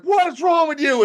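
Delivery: high, shouted hammed-up rage; mock dad shout. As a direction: bellow at the kid who spilled the milk. Dad yells at toddler.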